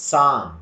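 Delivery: Psalm with silent p, said sam; correct psalm. Shown as a demonstration. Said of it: "Don't say". (Psalm ಅನ್ನು p ಇಲ್ಲದೆ ಸಾಮ್ ಎಂದು ಹೇಳಿ)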